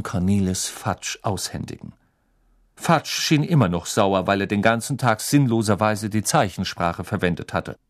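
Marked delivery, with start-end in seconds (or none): none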